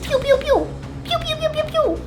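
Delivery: high playful pew pew blasts; laser pew noises. Pretend. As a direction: fire off cartoon laser sounds. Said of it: "I'm under attack".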